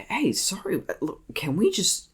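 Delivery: conciliatory retold plea; retold apologetic appeal. Retold apologetic call back.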